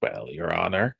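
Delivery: put-on old man voice. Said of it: "The old man voices on point".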